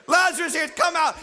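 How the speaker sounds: high-pitched acted summons; calling out. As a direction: call a man back out of heaven. Acting the call to Lazarus again.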